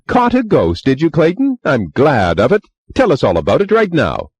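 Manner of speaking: high, loud excited shout; eager shout. Acted. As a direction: call it out, eager for the story.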